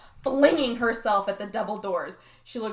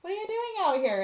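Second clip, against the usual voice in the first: surprised dad; 9 semitones higher than usual. Retold or imitated. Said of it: "he was like".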